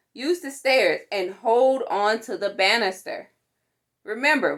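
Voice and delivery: ordinary delivery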